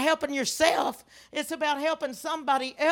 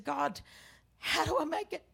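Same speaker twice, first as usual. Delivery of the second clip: soft, higher, fragile plea; hushed prayer; 3 semitones higher, 7 dB quieter than usual. Retold or imitated. Widow in grief asks God, hushed plea. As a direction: ask God quietly, almost to yourself. Same prayer repeated, softly.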